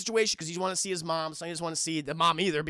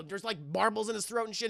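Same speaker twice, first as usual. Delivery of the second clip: ordinary delivery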